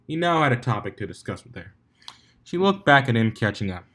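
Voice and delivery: ordinary delivery, nothing marked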